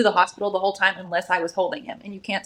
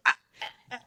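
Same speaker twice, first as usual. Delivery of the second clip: soft, voiceless stammering; lost for words, barely breathed; speechless sputter; 8 dB quieter, much faster than usual. Pretend. Mother imagines being outwitted by her son, a soft sputter. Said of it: "I'm just gonna be like".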